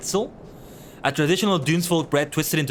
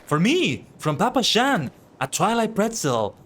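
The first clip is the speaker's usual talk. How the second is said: higher, excited voice over a favorite treat; delighted NPC; 8 semitones higher than usual. Acted. Character receiving a Twilight pretzel from Papa Shan, delighted. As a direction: gush like someone handed their favorite snack.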